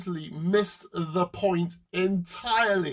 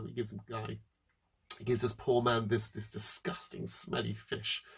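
ordinary talk

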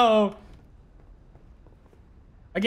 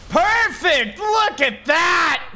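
triumphant shout; 8 semitones higher, much slower than usual. Real, not acted. Triumphant kill in game.

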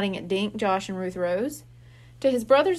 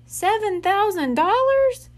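high, loud squeak of disbelief; shocked boy squeaking at the amount; 10 semitones higher, 6 dB louder, slower than usual. Acted. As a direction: squeak the sum out, stunned. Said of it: "Josh squeaked".

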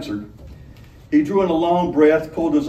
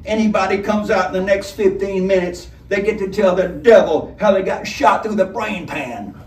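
higher, louder menacing warning; robber threat; 7 semitones higher, 4 dB louder than usual. A narrator's reading. Robber warning the room from the doorway, menacing. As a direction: promise death coolly.

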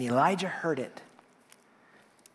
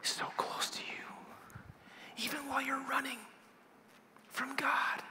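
soft hushed whisper; 10 semitones higher, 13 dB quieter than usual. Shown as a demonstration. Preacher showing God's closeness, whisper. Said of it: "You know why God whispers".

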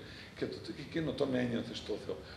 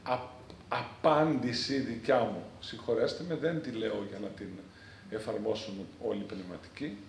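ordinary talk, nothing marked

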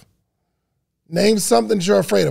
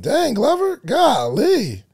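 playful exclamation; 6 semitones higher, slower than usual. Real, not acted. Teasing reply to a viewer.

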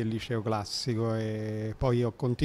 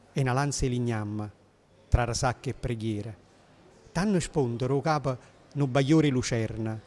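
put-on poem recited in old Neapolitan dialect. Shown as a demonstration. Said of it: "scrive in un napoletano antico".

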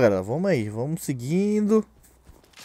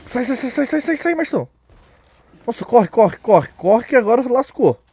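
high panicked yelling; frantic, fleeing cries; being chased; 6 semitones higher, 6 dB louder than usual. Real, not acted. Monsters chasing, fleeing in panic.

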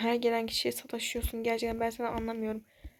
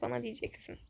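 usual speaking voice

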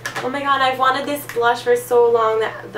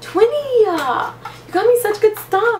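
gushing thanks; 12 semitones higher, 3 dB louder than usual. Real, not acted. Thanking her twin for gifts.

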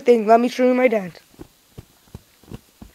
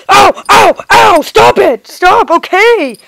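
higher, louder ow cries; pained yelling; 7 semitones higher, 7 dB louder, slower than usual. Pretend. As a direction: yelp as if getting hit. Toy being hurt during dance.

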